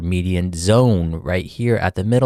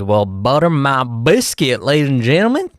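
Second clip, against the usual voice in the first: Texan exclamation; 4 semitones higher than usual. Pretend. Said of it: "'cause of my Texan accent".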